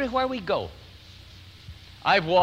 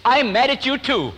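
playful comeback; 8 semitones higher, 5 dB louder than usual. Retold or imitated. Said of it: "came back with this".

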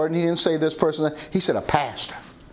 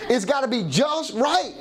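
high, drawn-out mock insistence; imitated fussy son; 8 semitones higher, slower than usual. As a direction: stretch it out like a son being particular. Imitates son insisting on his hair.

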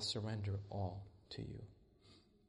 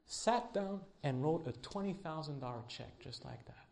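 normal talking voice

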